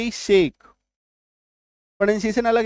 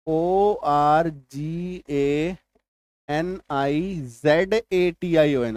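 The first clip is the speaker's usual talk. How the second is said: letters of organisation drawn out very slowly; slow spelling; 2 semitones lower, much slower than usual. Shown as a demonstration. Teacher spelling organisation for the class, very slowly. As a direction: spell each letter slowly as you write. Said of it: "जैसे कि ये देखिए".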